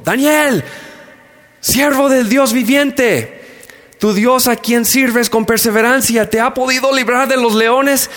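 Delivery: high, anguished calling out; anguished cry. Acted. King calling down into the lions' pit, anguished and high. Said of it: "gritó a Daniel con voz angustiada".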